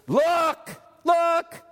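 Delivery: loud high call to look; voice of Jesus calling out. Acted. Jesus on the throne calls to his people, loud high cry. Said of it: "Jesus sitting on the throne says".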